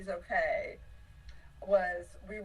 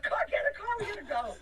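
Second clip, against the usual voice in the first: high, loud retold hurry-up; shrieky rallying cry, playfully acted; urgent mock call; 13 semitones higher, 4 dB louder, faster than usual. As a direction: imitate yelling everyone into the car. Retold urging everyone into van.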